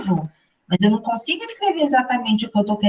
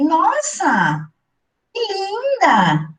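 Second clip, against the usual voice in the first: high, slow, delighted compliment; sincere praise; 9 semitones higher, much slower than usual. Shown as a demonstration. Complimenting a friend's photo in a new outfit, sincerely delighted. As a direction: gush over the new outfit, meaning it. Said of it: "Perceberam que tem uma ironia na segunda fala".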